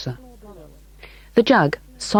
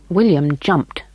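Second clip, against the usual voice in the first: ordinary delivery, nothing marked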